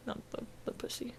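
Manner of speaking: hushed whispered joke; whispering. Real, not acted. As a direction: whisper it like a naughty aside. Whispered naughty correction.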